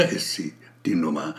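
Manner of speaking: soft whispered reveal of the number; hushed reveal. A narrator's reading. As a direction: breathe it out like a discovery. Suspense as number appears.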